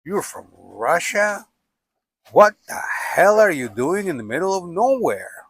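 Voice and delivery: mock the driver's disbelief at meeting a Russian; incredulous pickup driver. Montana trucker stunned to meet a Russian hitchhiker, incredulous. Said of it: "They would be like".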